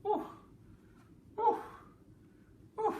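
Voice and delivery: short startled clucks; shocked chicken. Pretend. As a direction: do a dazed, shocked hen. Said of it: "See if you like my chicken impression".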